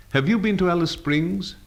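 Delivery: high-pitched simple question. Shown as a demonstration. Teacher posing example to be answered no, simple question. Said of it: "a simple question".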